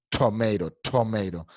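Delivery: tomato with a che-like T and a flapped t; chomeiro-style tomato; American tomato. Shown as a demonstration. Teacher models tomato the American way for the class. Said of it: "Es más fuerte el".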